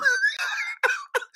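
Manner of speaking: a high, breathy burst of laughter. Real, not acted. Laughs while mocking the debaters. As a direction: cracks up laughing at his own joke. Laughing at his own joke.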